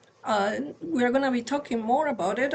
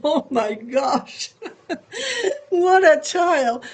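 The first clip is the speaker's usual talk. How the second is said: real laughter, higher and louder at the end; laughing disbelief; 5 semitones higher, 5 dB louder, slower than usual. Real, not acted. Mother retelling her son's cheeky remark, laughing through it. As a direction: laugh it out, half overwhelmed.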